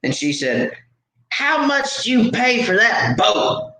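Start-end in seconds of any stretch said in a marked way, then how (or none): 1.3-3.7 high, drawn-out indignant demand; upset woman. Retold or imitated. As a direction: imitate an irate woman confronting a stranger. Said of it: "you seem like you're upset".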